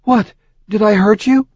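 high, startled worry; concerned. A narrator's reading. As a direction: jump up with sudden concern. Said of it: "concerned over what he meant".

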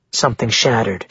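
usual speaking voice

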